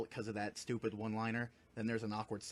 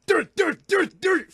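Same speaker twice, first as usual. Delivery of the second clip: loud, high mouth-made pistol blasts; imitated gunshots; 16 dB louder, slower than usual. Hero shoots possessed girl several times, loud imitated gunshots. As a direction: fire off repeated high shot noises. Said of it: "shoots Amy several times, going".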